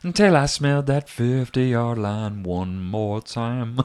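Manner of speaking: country singer voice. Pretend. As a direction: tell it like a sad country song. Parodies [name] song lyrics.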